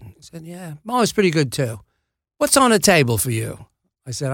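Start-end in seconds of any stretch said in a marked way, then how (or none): 0.4-3.6 casual, hammy Cockney impression; rough Cockney take-off; Michael Caine. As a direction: chat like a bad imitation of a London film star. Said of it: "This is a terrible Michael Caine impression".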